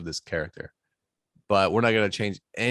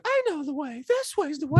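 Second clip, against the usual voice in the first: put-on childlike voice. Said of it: "he tells his father".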